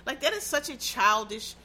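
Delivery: normal talking voice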